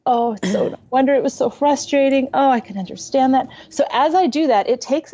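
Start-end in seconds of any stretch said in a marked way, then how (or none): 0.1-3.5 empathizing. Retold or imitated. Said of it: "So I'm like".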